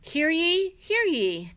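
booming mock town crier call. Mock proclamation before reading.

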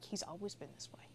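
hushed whisper, barely any voice; soft unvoiced whisper from proud parents. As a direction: whisper it like a fond secret. Parents praising their sweet son.

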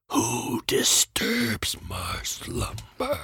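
slow, whispered cave-guardian voice; a hushed, ancient menacing voice; grinding stone statue. Pretend. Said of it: "That wasn't, that wasn't bad".

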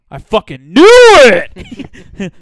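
huge drawn-out shout, pitched high; triumphant yell. Acted. Phone agent catching out a caller, triumphant yell. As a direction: explode with vindication, stretching the words.

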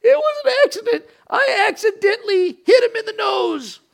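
squeaky mock child insisting it was an accident; little kid excuse. Preacher mimics a caught child, high mock excuse. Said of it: "they say".

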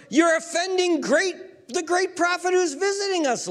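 high, offended townsperson; indignant scolding. Acted. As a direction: reproach them in a high, outraged voice. Scolding crowd voiced by preacher.

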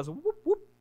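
two quick high-pitched whoops; playful whoops. Shown as a demonstration. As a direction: toss out little high "whoop, whoop" noises. Mimes a tricky magic switch.

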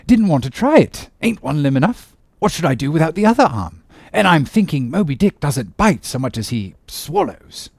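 hearty, high-pitched protest; breezy sea captain brushing it off; one-armed captain. Acted. Captain explaining why he spared the whale, hearty.

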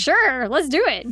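high, loud cheerful yes; eager Shippo. Retold or imitated. Shippo eagerly agreeing to throw Moroku in the river. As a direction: chirp it like a keen kid fox.

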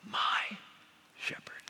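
my shepherd, fading to a whisper; hushed emphasis. Shown as a demonstration. Preacher stressing my shepherd to the congregation, hushed. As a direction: let my go breathless and soft. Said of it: "not only is he the shepherd".

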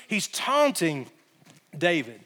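normal talking voice

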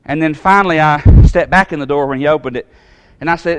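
usual speaking voice

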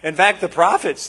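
usual speaking voice

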